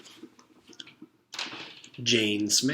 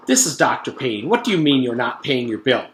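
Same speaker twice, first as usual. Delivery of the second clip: louder, indignant demand; angry phone call; 2 semitones higher, 7 dB louder than usual. Acted. Dentist scolds the mother on the phone, louder and angry. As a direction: confront her on the phone.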